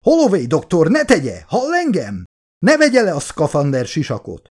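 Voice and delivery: magasabb, hangosabb üvöltés a rádión; kiabálva tiltja meg a sisak levételét; shouting officer. A narrator's reading. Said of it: "a szolgálatos tiszt üvöltése". (a tiszt a hajóról kiabál Holloway-re)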